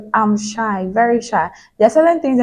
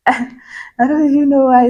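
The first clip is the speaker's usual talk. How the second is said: higher, louder exasperated repeat; 5 semitones higher, 4 dB louder than usual. Real, not acted. Vlogger baffled by her own self-pressure, exasperated. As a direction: say it high and loud after a laugh.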